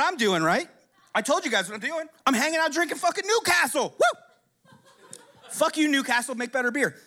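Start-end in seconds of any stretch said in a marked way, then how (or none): 4.0-4.7 high, loud "woo"; short, piercing cheer; celebratory whoop. Real, not acted. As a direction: let out a high party whoop. Whooping about drinking Newcastle.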